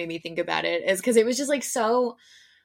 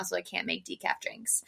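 whispered aside; 8 dB quieter than usual. Retold or imitated. Barista explains quietly.

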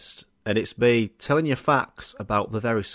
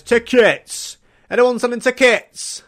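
loud, high street-hawker call; ticket tout shout; 11 semitones higher, 6 dB louder, slower than usual. Retold or imitated. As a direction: bellow it across the queue. Touts calling outside venue.

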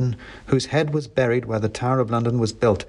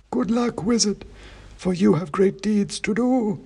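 high, slow, dusty oracle voice; dusty faint ancient head; 10 semitones higher than usual. A narrator's reading. Ancient king's head answering the wizard, slow high dusty chant. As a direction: intone it like a faint, dusty myth. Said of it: "he said".

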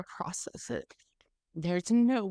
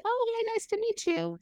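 very high, sing-song fake politeness; forced polite hello. Wife meeting the rep, very high forced sweetness. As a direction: play it sweet while stunned.

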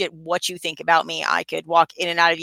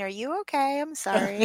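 higher, softer, sugary mock concern; mock sympathy; 6 semitones higher, 7 dB quieter than usual. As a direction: parody a friend's sweet worried apology. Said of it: "that sounded really mean".

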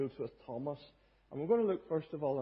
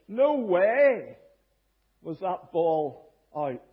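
John McEnroe disputing; 3 semitones higher, 12 dB louder, much slower than usual. Retold or imitated. Tennis player disputing the umpire's call, loud outrage. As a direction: explode on "no way", then slow, punched words. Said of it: "a great reputation for disputing".

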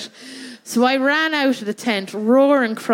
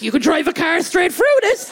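mock alarmed shout; 6 semitones higher than usual. Country folk in Dublin at Christmas, a mock panicked shout. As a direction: cry out in mock terror at the city. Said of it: "they'll be like".

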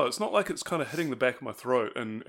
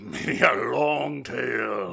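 put-on slow, drawn-out Yorkshire farmer or pirate accent; old sea-dog accent; 5 dB louder, slower than usual. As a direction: spin it slowly like an old salt telling a yarn. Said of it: "is that a Yorkshire farmer accent or a pirate accent?".

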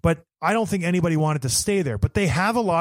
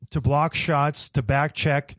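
ordinary talk

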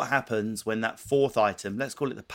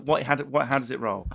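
ordinary delivery

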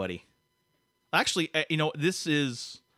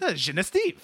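higher French pronunciation of "Genestive"; French-Canadian town name, pitched up; French accent; 4 semitones higher than usual. Acted. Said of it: "said it with a French accent".

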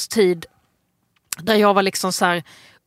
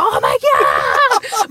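retold scream; 5 semitones lower, 5 dB louder, much slower than usual. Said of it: "Bara skriker".

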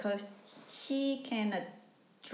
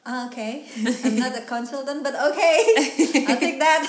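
amused reply; 5 semitones higher, 10 dB louder, faster than usual. Retold or imitated. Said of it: "I was like".

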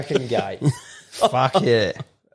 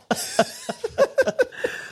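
high burst of laughter, softer; 4 dB quieter than usual. Real, not acted. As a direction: giggle high and light. Laughing after the story.

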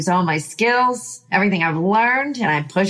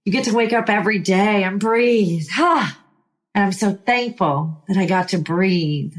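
ordinary talk, nothing marked

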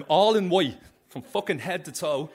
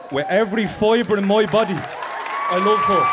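loud, much higher, voice cracking into a cry; heartfelt cry; 6 semitones higher, 9 dB louder than usual. Real, not acted. Man declaring love to his partner in the audience, crying out loud. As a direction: throw it out with everything you have.

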